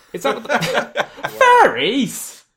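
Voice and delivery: breaking into laughter mid-cry; a cry that dissolves into a laugh; laughing outburst. Acted. The child cries out at Raven, laughing. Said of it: "you cry".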